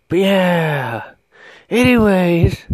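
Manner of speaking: sudden high strange voice; weird voice. Real, not acted. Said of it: "that was fucking weird as shit".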